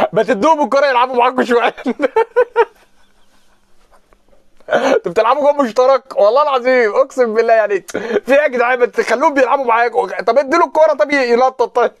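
high, loud mocking plea; mock outrage. Fan begging the team to share the ball, high, loud mock outrage. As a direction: squeal it like a wounded fan begging. Mock pleading about the Ahly match.

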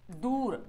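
दूर with a long held oo; stretched long oo. Shown as a demonstration. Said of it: "वाव को खींच रहे हैं". (दूर को खींचकर पढ़ें)

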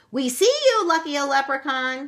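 high, loud gleeful shout. A narrator's reading. Meg shouting at the leprechaun as she pounces. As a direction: shout in triumph as you pounce. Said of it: "shouted Meg".

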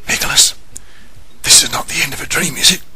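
whisper. Acted. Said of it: "he whispered across the room".

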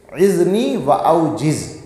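each Arabic word drawn out slowly; slow, measured quotation of the Prophet's words; slow Arabic recitation. Acted. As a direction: recite the hadith slowly and deliberately. Arabic hadith quoted in sermon.

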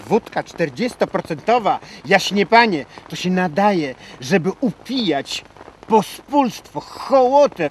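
scornful declamation climbing in pitch; indignant rant. Acted. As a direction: build from indignation to a high, loud peak. Rants about vodka for the rabble.

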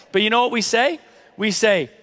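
normal talking voice

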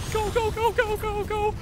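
high, urgent go go go; frantic urging. Real, not acted. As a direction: push yourself on, frantic. Urging escape.

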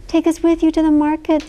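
eager twins begging mother; begging children. A narrator's reading. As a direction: beg like little girls. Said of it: "the twins begged".

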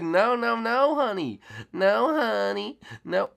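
high, sing-song no, honey; mock sassy refusal. Retelling how Nick showed no mercy, high mock sassy voice. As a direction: play the merciless friend teasing the victims. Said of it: "He said".

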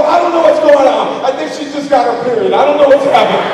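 loud, high, bewildered rant; comic retold panic; exasperated dad. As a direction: blurt helplessly at full volume.

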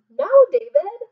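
high, loud scold. Shown as a demonstration. Teacher modelling a scold for the class. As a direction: lead the scold. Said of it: "tell him".

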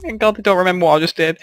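usual speaking voice

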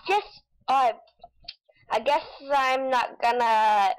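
ordinary delivery

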